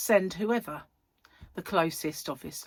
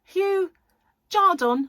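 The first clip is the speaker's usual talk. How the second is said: imitating Dave; 13 semitones higher, 10 dB louder, slower than usual. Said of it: "And he'd go".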